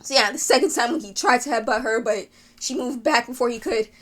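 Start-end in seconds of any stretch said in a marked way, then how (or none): none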